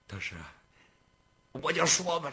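plain reading voice

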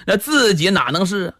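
usual speaking voice

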